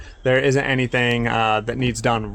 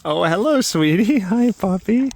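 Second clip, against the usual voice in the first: higher cooing hello to a puppy; 7 semitones higher than usual. Real, not acted. Greeting her sleepy puppy in higher baby talk. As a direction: lilt it up sweetly.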